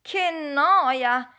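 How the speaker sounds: bored. Acted. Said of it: "disse uno di loro".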